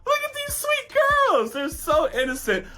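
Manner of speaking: high, drawn-out fawning coo; hammed-up falsetto "aww"; gushing dudes. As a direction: imitate guys melting over cute girls. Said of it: "all the dudes are like".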